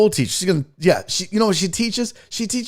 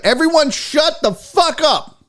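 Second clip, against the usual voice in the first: high, drawn-out mock shout; exasperated order; 11 semitones higher than usual. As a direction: yell for silence, hitting each word. Calling the chat to order.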